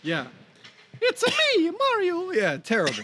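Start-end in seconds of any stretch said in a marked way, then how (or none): 1.0-2.3 hammed-up Mario, way up in the head voice; Mario impression. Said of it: "I don't think that's Mario".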